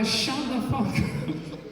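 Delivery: hungover yell. Retold or imitated. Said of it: "And I".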